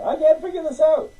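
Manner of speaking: high mock whine; a hint of helpless whining; whiny complaint. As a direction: admit it yourself, whining. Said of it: "Even I gotta say it".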